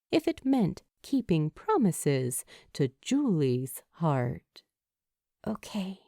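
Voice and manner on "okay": soft sighed okay; quiet resignation; sighing. A narrator's reading. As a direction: let it out on a breath. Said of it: "she sighs".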